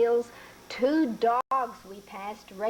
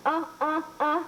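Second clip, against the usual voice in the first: stammering uh sounds; 6 semitones higher than usual. Acted. Boy gets cat's attention.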